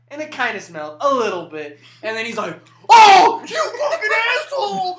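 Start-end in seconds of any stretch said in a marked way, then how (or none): 2.9-5.0 very high, loud imitated shout; imitating a friend screaming abuse; mock outraged yell. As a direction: explode in hammed-up outrage. Acting out friend's outraged reaction.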